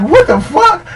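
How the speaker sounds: high-pitched mock disbelief; retold shock. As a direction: voice leaps up in comic shock.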